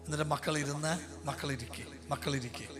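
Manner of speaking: soft, low prayer-leading voice; quiet guided prayer. Acted. Preacher softly leading couples through confession, hushed. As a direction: guide them gently, hushed and solemn.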